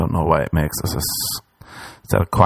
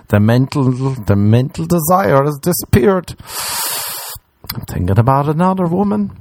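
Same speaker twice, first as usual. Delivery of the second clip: higher, slowed mock-serious; mock dramatic realization; 6 semitones higher than usual. Mid-sex to himself, higher mock-solemn. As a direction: announce it like a grave discovery.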